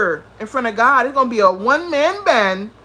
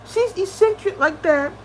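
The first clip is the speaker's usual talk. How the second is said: mocking quote; 6 semitones higher, 3 dB quieter than usual. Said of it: "Would they be like".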